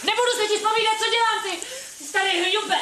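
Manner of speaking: high, loud, furious insults; angry yelling. Acted. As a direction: scream the insult at him. Wife yells at the husband.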